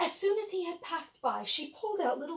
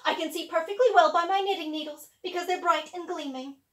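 haughty stepsister; 3 semitones higher, 8 dB louder than usual. Acted. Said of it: "said the sister who knitted".

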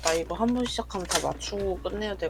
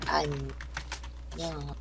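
sleepy goodbye; 5 semitones lower, 4 dB quieter, much slower than usual. Pretend. 자러 갑니다.

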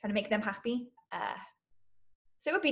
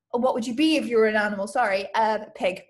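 usual speaking voice

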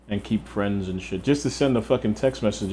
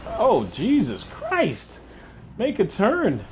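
startled; 9 semitones higher, much slower than usual. Real, not acted. Near crash in game.

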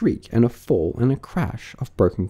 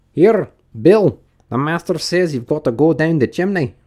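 high, raised rustic calling voice; workman passing orders; 7 semitones higher, 5 dB louder than usual. Acted. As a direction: call the master's order over to him. Workman calls out order to Bill.

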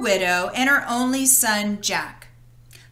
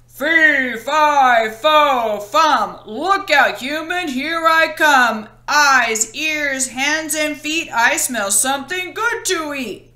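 booming, louder giant chant; shouting giant; 3 semitones higher, 4 dB louder, slower than usual. Acted. Giant announces himself while sniffing for Jack, booming chant. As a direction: stomp in as a hungry giant. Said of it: "The giant came in shouting".

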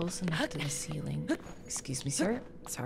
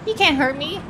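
taunting; 10 semitones higher, 12 dB louder than usual. Real, not acted. Taunting enemy in game.

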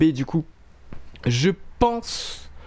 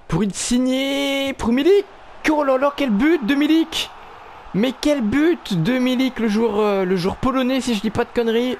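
excited sports commentary; 8 semitones higher than usual. Acted. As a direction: build up and explode on the goal. Goal in FIFA career commentary.

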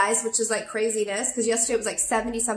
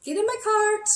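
much higher, playful "Sure, get in my cart"; squeaky excited inner voice; eager mock reply; 10 semitones higher, slower than usual. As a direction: pitch up high like you can't resist it. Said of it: "So I was like".

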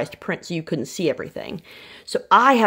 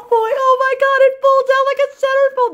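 very high, loud, gushing squeal; breathless fangirl shriek; delighted squeal; 8 dB louder than usual. Real, not acted. Thrilled unboxing reveal.

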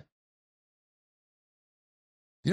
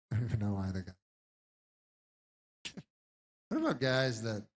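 ordinary delivery

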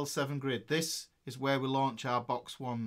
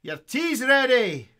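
imitating a Scottish mum, higher, louder and slow; a Scots mum calling her lad down for tea, in a Scots accent; 7 semitones higher, 9 dB louder, slower than usual. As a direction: call it down the stairs like a Scots mother. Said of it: "In the Scots accent".

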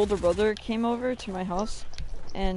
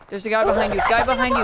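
urgent callout; 2 semitones higher, 9 dB louder than usual. Real, not acted. Warning a teammate.

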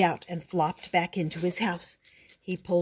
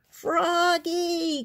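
put-on high, drawn-out call for a child; 10 semitones higher than usual. A narrator's reading. Mother frog calling her son into the pond, calling out. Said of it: "called his mother".

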